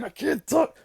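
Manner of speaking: high, tight whine of panic; panicked inner reply. Retold or imitated. The silent mind answering back, high mock panic. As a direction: squeal it like you've lost your words. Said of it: "And I was like".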